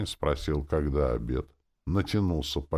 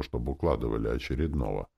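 plain reading voice

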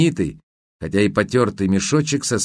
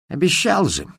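offended; 5 semitones higher than usual. Acted. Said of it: "обиделся Мутила".

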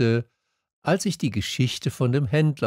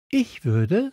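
put-on high young voice; Little Prince; 9 semitones higher than usual. A narrator's reading. Prince ponders the saved minutes, in a high voice. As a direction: muse in a high, light voice. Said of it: "sagte der kleine Prinz".

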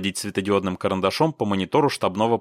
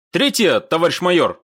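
raised, crisp military report; prompt reply; 8 semitones higher, 5 dB louder than usual. A narrator's reading. Officer reporting to the major, prompt reply. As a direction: answer the major without a moment's hesitation. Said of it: "без запинки ответил Лаптев".

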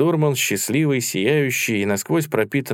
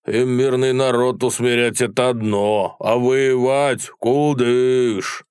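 higher, drawn-out mocking taunt; spiteful jeer; 3 semitones higher, 4 dB louder, slower than usual. A narrator's reading. Soldier in the dusk sneering at Cossacks, spiteful taunt. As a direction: stretch the last word scornfully. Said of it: "злобный голос".

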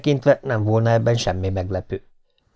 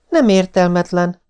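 higher wife voice, quick to object; wife's retort; 6 semitones higher than usual. A narrator's reading. Wife contradicting husband, mock indignant. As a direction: she contradicts him sharply. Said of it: "mondta ő".

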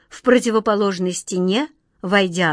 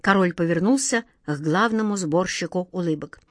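usual speaking voice

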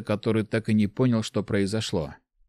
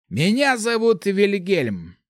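higher, slow, stately mage; 10 semitones higher, 3 dB louder, slower than usual. A narrator's reading. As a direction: announce his name slowly. Mage introduces himself.

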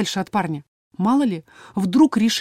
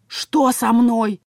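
hissing; 3 semitones higher, much slower than usual. A narrator's reading. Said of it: "прошипел Мира совсем не моим голосом".

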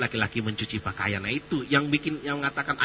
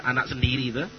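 ordinary talk, nothing marked

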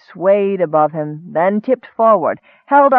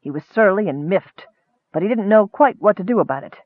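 plain reading voice

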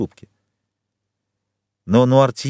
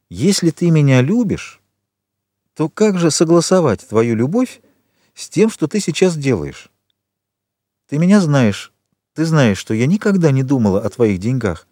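higher-pitched, insistent appeal; earnest pleading; 5 semitones higher than usual. A narrator's reading. Granddaughter questioning her grandfather's love, pleading earnestly. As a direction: plead with him, voice lifted.

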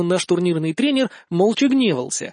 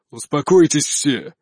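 low, slow voice of authority; commanding; 4 semitones lower, slower than usual. A narrator's reading. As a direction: order the room to calm down. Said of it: "властно распорядился".

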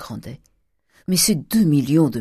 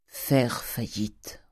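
Faire faillite drawn out slow and low; slow grave words; 4 semitones lower, 6 dB quieter, much slower than usual. A narrator's reading. The father answering his daughter, slow and grave. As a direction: lean heavily on each word.

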